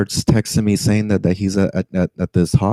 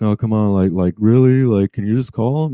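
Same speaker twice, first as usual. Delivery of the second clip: imitated pleading; 2 semitones higher than usual. Passenger begging driver to call his friend, imitated pleading. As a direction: imitate a passenger pushing for a favor.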